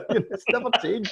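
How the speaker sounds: high, laughing through words; giggling while talking. Real, not acted. Laughing about splitters.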